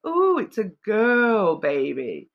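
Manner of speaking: put-on slow, stress on "girl" in "Oh, it's a girl baby", with the word "girl" leaned on hard; emphatic girl baby line. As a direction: lean into "girl" and drag it out slowly. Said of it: "I'm just hearing that phrase".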